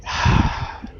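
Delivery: long, voiceless sigh; exasperated sigh. Acted. Weary of the device's boasting, exasperated sigh. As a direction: breathe out, giving up.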